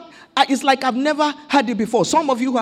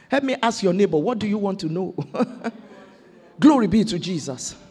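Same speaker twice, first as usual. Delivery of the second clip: ordinary talk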